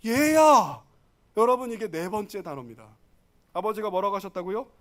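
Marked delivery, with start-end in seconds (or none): 0.1-0.8 put-on loud call to a child; father calling. Father answering his elder son, calling out. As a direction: call out to your son, loudly. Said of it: "아버지가 뭐래요".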